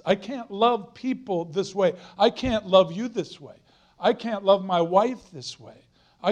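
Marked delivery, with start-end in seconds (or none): none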